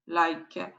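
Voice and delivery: normal talking voice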